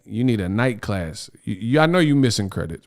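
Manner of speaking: usual speaking voice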